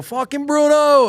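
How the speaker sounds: high, loud, drawn-out fan yell; excited fan shout. Retold or imitated. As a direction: belt it out like a fan in the stands. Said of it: "so excited and fully in character".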